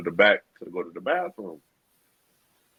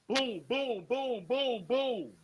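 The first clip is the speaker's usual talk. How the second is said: gunshot sounds; 13 semitones higher, 4 dB quieter, much slower than usual. Retold or imitated. Imitating gunshots.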